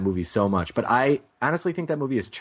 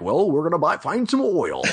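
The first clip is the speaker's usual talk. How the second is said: higher put-on old-time American accent, hammed up; bad American accent impersonation; 6 semitones higher than usual. Mocking Daniel Day-Lewis's oilman role in a bad American accent. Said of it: "that's the worst impersonation".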